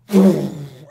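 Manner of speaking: loud blown whoosh; imitated fireball blast. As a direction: blow out a fireball noise loudly.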